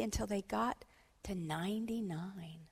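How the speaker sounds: ordinary delivery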